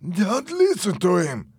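high, slow, insistent command; acted urgent insistence; urgent order. A narrator's reading. Urgent counter-order in a quarrel.

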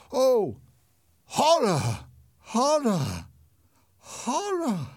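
high, loud gasps of horror, building; horrified cries. Acted. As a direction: cry out in terror at the face. Unmasking reveals terrible face.

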